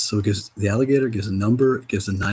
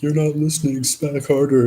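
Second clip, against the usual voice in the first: a higher, louder mock spirit voice; spirit scolding; 5 semitones higher, 6 dB louder than usual. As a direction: play the spirit telling you off. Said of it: "spirit being like".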